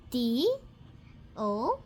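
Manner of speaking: ordinary talk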